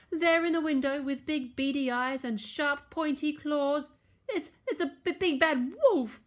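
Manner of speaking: high, stammering panicked piglet; chattering with fear. A narrator's reading. Boris spots the wolf in the window, chattering fearfully. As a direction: stutter it out, pitched high. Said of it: "Boris chattered".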